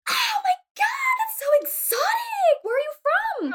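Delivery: gasp, then squeal it very high; hammed-up fascinated fawning; mock gushing amazement. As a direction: gush as if meeting a rarity. Mimicking someone gushing at exotic name.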